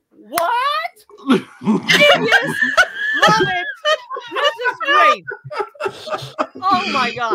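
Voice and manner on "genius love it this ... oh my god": high, loud and laughing; excited raving. Real, not acted. Raving about Lady Terminator to friends, laughing shouts. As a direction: shout your delight, laughing.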